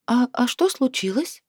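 high, feigned innocent question; feigned innocence. A narrator's reading. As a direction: play dumb, all innocence. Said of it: "Я пыталась сделать невинное лицо".

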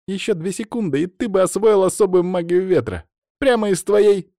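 mock him with rising excitement; gleeful teasing. A narrator's reading. Katya joking at Stas before bursting out laughing, gleeful.

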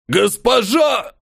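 slow, high gurgled cry; gurgling dying demon. A narrator's reading. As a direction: gurgle it out through blood. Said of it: "пробулькал демон Абсолют".

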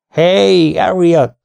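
loud, high bark across the corridor; gruff farmer hailing the vet; commanding call. A narrator's reading. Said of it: "szólt parancsoló hangom".

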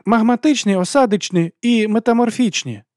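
high, loud schoolboy yell; eager shout. A narrator's reading. Larry shouting a quiz answer to the teacher, eager yell. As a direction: call out the answer high and forceful. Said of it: "заволав Ларі".

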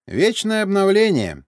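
declaimed loud and high; pronouncing grandly. A narrator's reading. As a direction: proclaim it like an oracle. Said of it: "вдруг изрёк Сергей".

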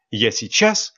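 plain reading voice

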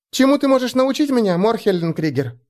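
put-on high young girl asking. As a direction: ask up brightly, like a girl. Elfie voiced by narrator.

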